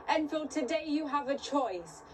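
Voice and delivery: normal talking voice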